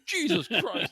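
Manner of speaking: high, loud mock exasperation; hammed-up angry outburst; imitating pissed-off dad. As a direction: play an outraged dad for laughs. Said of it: "my dad got fucking pissed".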